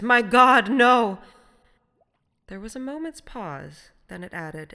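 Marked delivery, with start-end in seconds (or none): 0.0-1.2 "My God, no" very loud and high; horrified refusal, every word of "My God, no" hit hard; extraordinary emphasis. A narrator's reading. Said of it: "said the voice with extraordinary emphasis".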